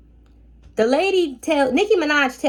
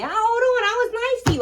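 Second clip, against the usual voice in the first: indignant, pitched much higher; much higher, offended "I was nice to you"; 13 semitones higher than usual. Retold or imitated. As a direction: protest that you were nice, voice shooting up. Indignant protest to the guest.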